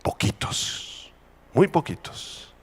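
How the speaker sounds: slow near-whisper; hushed emphasis. Real, not acted. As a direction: breathe it out slowly and softly. Preacher hushes to stress how few.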